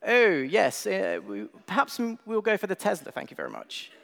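high, plummy upper-class English voice; posh British accent. Retold or imitated. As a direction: put on your poshest British voice. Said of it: "with the best British posh, posh voices we could muster".

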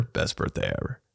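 whispered, almost breathless echo; hushed unvoiced aside; whispered repeat. Shown as a demonstration. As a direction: breathe it again softly to yourself. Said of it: "I said it, bro".

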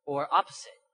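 normal talking voice

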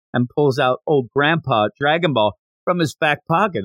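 usual speaking voice

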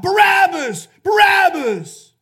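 high, full-voiced crowd chant; crowd shouting. Crowd demanding a prisoner from Pilate, loud shout. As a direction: yell the name out, stretching the repeat. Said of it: "They shout".

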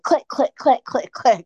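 high, quick mock click sounds; clicking along like walking fast through the airport; imitated heel clicks. Fan imitating her fast airport walk with playful high clicks. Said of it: "as I'm like".

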